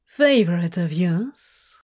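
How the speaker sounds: mock-sweet teasing question; sweetly. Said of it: "he asked sweetly".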